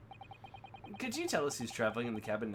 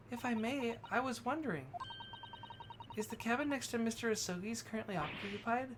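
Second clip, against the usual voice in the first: put-on higher, polite young lady voice; polite companion; 10 semitones higher, 3 dB quieter than usual. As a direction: ask sweetly and formally.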